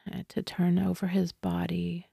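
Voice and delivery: hushed, barely voiced whisper. Real, not acted. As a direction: let the words fall to a whisper. Telling of her dog's death.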